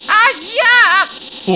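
very high, loud yelp; yelp of pain. A narrator's reading. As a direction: cry out in pain.

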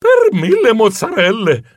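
high, exasperated exclamation; sbuffando. Acted. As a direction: huff a comic oath in dismay. (esclamazione acuta e sbuffante)

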